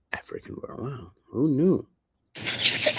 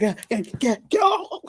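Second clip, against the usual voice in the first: much higher, rising panicked yeah yeah go; mock frantic thief; 4 dB louder than usual. Motorcycle thief flees in a panic, high frantic voice. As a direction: play a thief bolting on a motorbike. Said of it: "and they be like".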